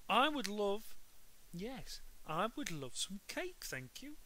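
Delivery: sweet Henry. A narrator's reading. Henry accepts cake sweetly.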